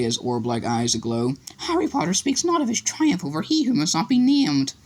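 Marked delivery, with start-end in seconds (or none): 1.6-4.7 much higher elf; reverent elf. A narrator's reading. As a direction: elfo reverente, voce acuta.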